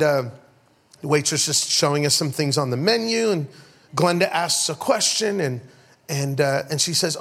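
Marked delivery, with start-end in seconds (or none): none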